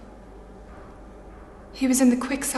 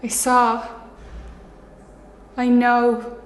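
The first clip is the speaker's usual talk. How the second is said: loud, stark declaration; two short, forceful statements; accusing outburst; 3 dB louder, slower than usual. Acted. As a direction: blurt it out with force, unable to stop. Acted confrontation on the dance floor.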